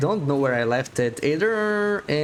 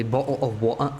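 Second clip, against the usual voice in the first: mock British accent. Shown as a demonstration. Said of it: "British accent".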